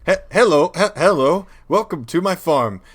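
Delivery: high, loud stuttering NPC greeting; glitching game townsperson. Acted. Townsperson glitching while showing off the farm, loud high stutter. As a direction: stick on syllables like a broken game character. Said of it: "like a video game glitch".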